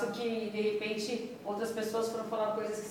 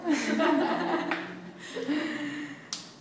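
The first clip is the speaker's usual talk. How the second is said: long amused laugh; genuine, drawn-out laughter; real laugh; 5 semitones higher than usual. Real, not acted. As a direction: burst out laughing after a joke. Jokes with Glaucia, then laughs.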